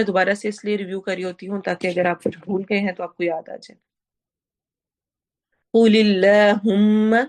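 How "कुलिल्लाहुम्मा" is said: louder chant with long held mm; Quran recitation. Shown as a demonstration. Teacher reciting loudly for students. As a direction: sing it out, holding the mm. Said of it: "मीम पर वो ना करेंगे".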